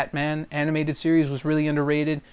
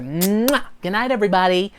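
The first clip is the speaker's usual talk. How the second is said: cartoon one-liner; 5 semitones higher than usual. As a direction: blow a kiss, then call out like a cartoon. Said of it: "the best I can do is one-liners".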